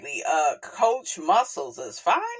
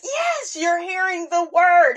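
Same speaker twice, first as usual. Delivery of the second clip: emphatic yes; 9 semitones higher, 3 dB louder than usual. Real, not acted. Emphatic declaration to viewers.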